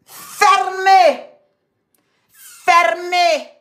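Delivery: loud shouted command; shouting. Real, not acted. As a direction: shout them down. Shouting to shut them up.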